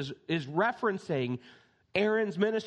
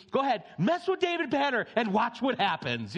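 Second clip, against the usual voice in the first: gleeful taunt; 6 semitones higher than usual. Retold or imitated. Kid taunting the bad guys.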